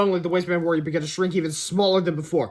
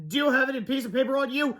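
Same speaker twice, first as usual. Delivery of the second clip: urgent cry; 7 semitones higher than usual. Acted. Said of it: "cried Fluffy".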